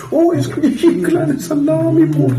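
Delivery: put-on very high and loud squeaky child voice; childlike voice. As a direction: squeal it like a thrilled little kid. Repeats high squeaky kid voice.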